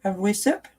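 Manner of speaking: high, coaxing pet voice. Real, not acted. Mommy offering Charlotte water, high coaxing baby talk. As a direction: lure her in sweetly, voice up high.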